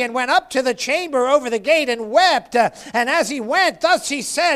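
high, driving pulpit cadence; impassioned preacher in full flow; fervent preaching. Real, not acted. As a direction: hammer each repeated phrase, building to a loud peak.

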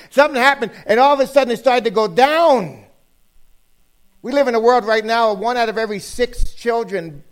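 high, insistent pulpit delivery; emphatic preaching. Real, not acted. Preacher stresses how recent the date is, emphatic preaching. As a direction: hammer the point home to the congregation. Preacher drives home falling statistics.

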